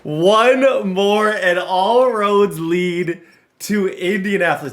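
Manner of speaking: loud hyped rallying call; hyped shout. Real, not acted. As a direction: shout it slow and excited.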